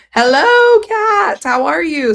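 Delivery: high, loud, gushing hello; excited cat greeting. Real, not acted. Streamer suddenly greets the cat, high and loud. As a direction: squeal a big hello at a pet.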